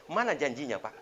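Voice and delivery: high, loud pestering question; loud nagging demand. Acted. Wife asking husband where his promise is, loud nagging. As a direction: badger him as the acted wife.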